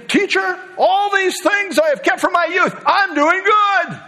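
put-on proud young man. Rich young man answering Jesus, high and smug.

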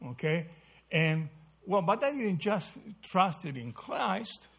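ordinary talk

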